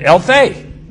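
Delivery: "Elthei" called out loud and high as a command. Retold or imitated. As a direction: bark "Elthei" as an order to step out. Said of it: "a commanding word".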